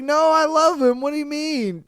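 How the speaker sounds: high, hammed-up girlfriend protest. Host voicing girlfriend defending him to cops, high and mock-indignant. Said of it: "And she's just like".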